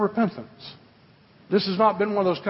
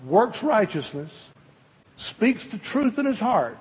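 scripture reading. Acted.

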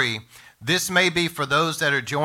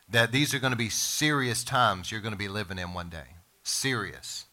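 grave, hushed warning; 5 semitones lower, 6 dB quieter than usual. Real, not acted. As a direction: drop low and soft. Preacher lowers voice for solemn warning.

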